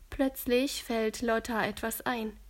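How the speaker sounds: plain reading voice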